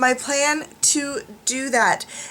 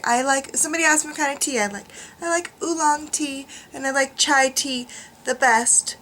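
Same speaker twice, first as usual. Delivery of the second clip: ordinary talk, nothing marked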